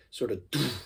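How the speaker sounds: soft doof bass sound. Shown as a demonstration. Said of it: "that bass sort of".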